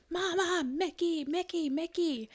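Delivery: high-pitched imitation of a demanding toddler; mock screaming child. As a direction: mimic a kid yelling insistently for a show. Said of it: "He will scream at me".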